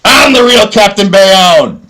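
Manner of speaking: high, loud mock challenge, hammed up, building to a full shout; hammed-up callout. A man calls out his rival Captain Bayonne, loud and high. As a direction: yell the challenge up and out like a rally cry. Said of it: "I'm gonna make a clip".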